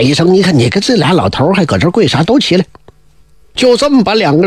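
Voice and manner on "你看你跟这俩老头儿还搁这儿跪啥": plain reading voice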